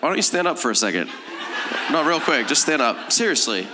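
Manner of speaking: ordinary talk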